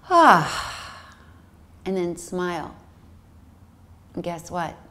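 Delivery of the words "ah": loud, higher open ah sigh. Shown as a demonstration. Teacher leading the class in a loud sigh. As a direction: let out a big releasing sigh. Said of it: "Just let out that sigh".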